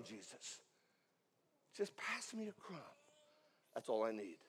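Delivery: sudden drop to a near whisper; hushed pleading. Acted. Mother asking Jesus for one crumb, hushed pleading. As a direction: plead very softly, barely voiced. Plea drops to a hush.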